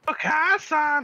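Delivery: high shrill call. Real, not acted. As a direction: shout it out happy. Calling out in a game.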